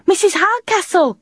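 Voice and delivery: high calling cry across distance. Acted. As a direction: call out her name. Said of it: "she called".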